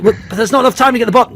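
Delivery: hammed-up panic; mock teammate panic. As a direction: rush it like a worried teammate. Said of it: "It's like".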